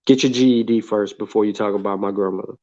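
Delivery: ordinary delivery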